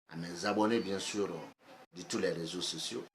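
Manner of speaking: ordinary talk, nothing marked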